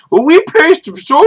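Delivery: put-on very high, loud Indian-accented insistence; hammed-up Indian accent; mock Indian accent. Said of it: "from Indian recruiters".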